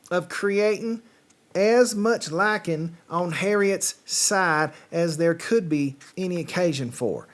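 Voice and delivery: put-on playful Southern accent on a British novel. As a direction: read it like a Southern storyteller. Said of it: "it's been a week since I've done a Southern accent".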